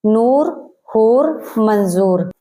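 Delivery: नूर हूर मंजूर with a long full oo; clear long oo. Shown as a demonstration. Teacher demonstrating the long oo sound to students. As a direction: stretch the oo in नूर. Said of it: "पेश के साथ पढ़ा जा रहा है".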